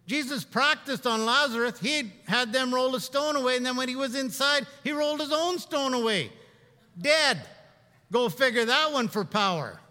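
loud, emphatic sermon delivery; fervent preaching. Acted. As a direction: drive it home to the pews. Preaching with rising force.

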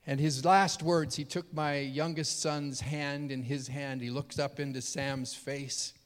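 ordinary talk, nothing marked

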